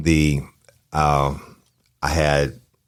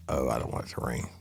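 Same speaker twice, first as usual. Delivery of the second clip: much softer, lower worried mutter; nervous muttering; 5 semitones lower, 12 dB quieter than usual. Retold or imitated. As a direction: mutter it under your breath, anxious. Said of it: "I'm like".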